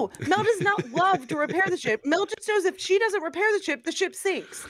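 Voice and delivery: indignant. Real, not acted. Indignant denial about herself.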